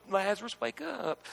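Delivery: soft, weak, mocking little call; a sissy whisper of a call; puny little voice. Preacher mocking a weak Jesus, softly calling Lazarus. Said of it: "this sissy little, puny little voice".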